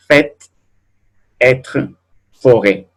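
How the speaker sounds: "fête, être, forêt" each with open eh; spaced out, open the vowel in "fête, être, forêt". Shown as a demonstration. Teacher repeating circumflex examples, open Ê. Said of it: "E, bien se pronuncia".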